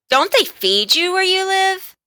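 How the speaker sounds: high, loud sneering jibe; mock him with contempt; bully's loud high sneer. A narrator's reading. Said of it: "he sneered".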